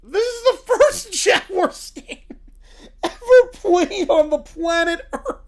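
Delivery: high, slow, laugh-choked reading. Real, not acted. Retrying the Jaguars fun fact, struggling through laughter. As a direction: fight through giggles to finish.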